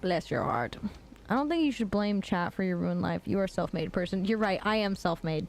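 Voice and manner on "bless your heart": low, syrupy Southern belle. Acted. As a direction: try a Southern belle accent, pitched low. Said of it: "Can I do a Southern Belle voice?".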